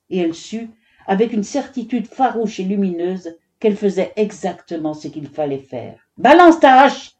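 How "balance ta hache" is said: high, loud shouted order; insistent command. A narrator's reading. Foreman pressing the hesitant woodcutter, commanding. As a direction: demand it forcefully, out of patience. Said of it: "intima une nouvelle fois le chef".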